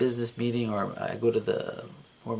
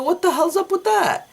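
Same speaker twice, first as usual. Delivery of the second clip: very high, loud outraged question in a changed voice; 7 dB louder than usual. Retold or imitated. Dreamer sees Kate kissing his old manager, outraged high yell. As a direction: yell it in disbelief.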